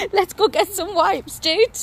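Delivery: laughing through the words. Real, not acted. Mother laughing to her toddler about the mess. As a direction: giggle as you say it, up high.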